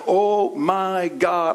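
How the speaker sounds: very slow, drawn-out cry to God; a believer turning from a mistake; sinner's prayer. Pretend. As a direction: turn back to God with feeling. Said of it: "you gotta be like".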